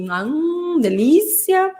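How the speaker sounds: high lilting "hum, delícia"; drawn-out intonation. Retold or imitated. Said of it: "bem prolongado, com a entonação". ("hum, delícia" prolongado, em tom agudo)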